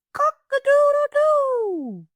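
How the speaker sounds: high, slow, stretched crow; rooster crow. Acted. Rooster calls out at Turkey, high slow crow. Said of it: "crowed the rooster".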